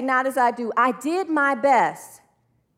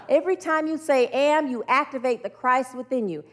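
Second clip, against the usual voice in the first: ordinary talk, nothing marked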